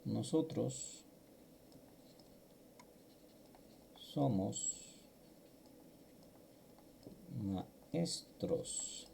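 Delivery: each word set apart with long pauses, soft; teacher models the phrase slowly for students to repeat back, word by word. Shown as a demonstration. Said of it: "vamos a repetir".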